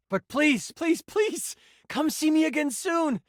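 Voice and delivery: high-pitched, excited begging; bubbly, high, insistent plea; eager pleading. Acted. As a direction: plead eagerly, pitch climbing with each please. Begging player to return.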